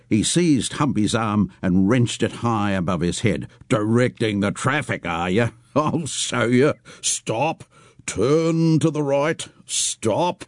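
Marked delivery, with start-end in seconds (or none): 3.7-10.4 mocking traffic cop. Mocking Humpy, directing traffic.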